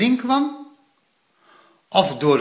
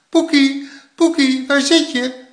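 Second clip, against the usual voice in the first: high, loud searching call; calling in chorus; 10 semitones higher, 5 dB louder than usual. A narrator's reading. Both grandparents searching for Poekie, loud calling in chorus. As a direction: shout the name as if two call together. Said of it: "riepen ze beiden in koor".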